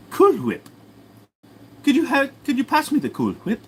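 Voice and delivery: very high cartoon-baby voice; Stewie. Baby asks Brian for Cool Whip, high cartoon voice. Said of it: "Can I do Stewie from Family Guy?".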